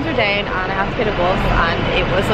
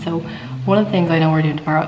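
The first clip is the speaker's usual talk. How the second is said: ordinary talk, nothing marked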